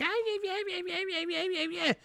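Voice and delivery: high-pitched, soft wailing babble; mock crying. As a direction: imitate a weeping woman with squeaky, repeated yelps. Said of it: "when she's crying".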